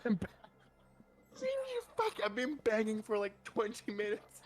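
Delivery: high-pitched, worked-up griping; exasperated complaint. Acted. Climber scolds whoever ignored the banging, exasperated. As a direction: let out pent-up outrage in a squeal. Player acts exasperated window banger.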